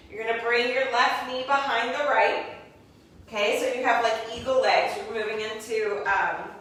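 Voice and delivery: ordinary delivery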